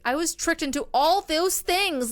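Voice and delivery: mock outburst of wronged innocence; Ross. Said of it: "she was like Ross".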